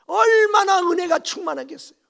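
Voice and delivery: loud, high-pitched exclamation; fervent preaching cry. Real, not acted. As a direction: cry out overwhelmed by grace. 은혜에 벅찬 설교자의 외침. (벅차서 높고 크게 외치는 설교)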